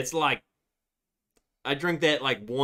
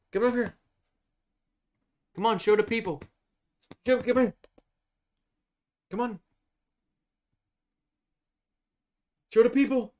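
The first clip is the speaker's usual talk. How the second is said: much higher, sing-song voice for the dog; high coaxing pet talk; 8 semitones higher, much faster than usual. Real, not acted. Owner coaxing dog to show herself to viewers, high sweet coaxing. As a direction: coax her up like talking to a puppy.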